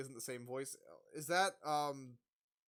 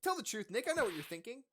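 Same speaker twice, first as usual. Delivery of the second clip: lightly voiced girl; 6 semitones higher, 5 dB louder than usual. Voicing Maya.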